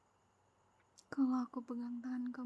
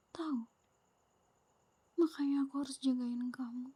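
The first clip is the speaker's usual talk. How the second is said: normal talking voice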